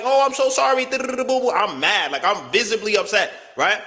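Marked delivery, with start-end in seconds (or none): 0.0-0.8 higher, lightly imitated sorry; a hint of the girl's sweet apology; copying her again; mock apology. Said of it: "She's like".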